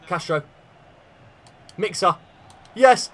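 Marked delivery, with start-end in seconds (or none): 2.8-3.1 sudden high, loud yes; excited cheer. Real, not acted. Thinks the last chance is in, sudden excited cheer. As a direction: jump at it.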